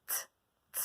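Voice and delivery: "T" sounded alone, twice; isolated sound. Shown as a demonstration. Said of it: "on va enlever le".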